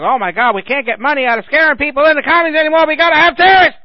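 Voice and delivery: mocking panicked profiteer. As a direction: lampoon a frantic fearmonger. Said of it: "the same people who's like".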